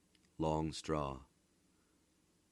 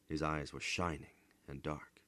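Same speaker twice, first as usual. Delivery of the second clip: plain reading voice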